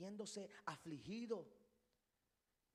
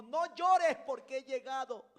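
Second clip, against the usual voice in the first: loud, higher preacher's cry; emphatic command; 6 semitones higher, 15 dB louder than usual. Acted. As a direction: proclaim it forcefully as Jesus to the widow. Acted command of Jesus to widow. (orden proclamada a viva voz)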